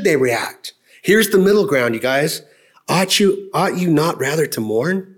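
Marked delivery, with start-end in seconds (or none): none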